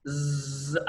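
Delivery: long, drawn-out buzzing zzz; buzzing sound. Shown as a demonstration. Teacher showing learners a sibilant, long buzzing zzz. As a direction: hold a voiced zzz like a buzz. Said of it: "buzzing అని sound".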